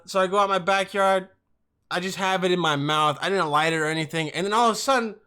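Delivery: ordinary talk, nothing marked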